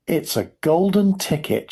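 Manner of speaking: high, excited exclamation at moderate volume; mild shout. A narrator's reading. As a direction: call it out high, like a thrilled shopkeeper. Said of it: "shouted the shopkeeper".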